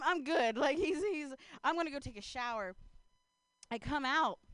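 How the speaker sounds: ordinary talk, nothing marked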